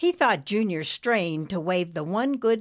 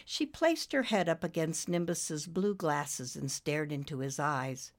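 ordinary delivery

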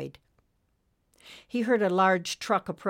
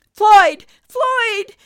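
high, full-force shout of alarm; 16 dB louder than usual. A narrator's reading. Friend sees the trucker collapsing, desperate shout. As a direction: scream the name, very loud and high. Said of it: "Nimbus shouted".

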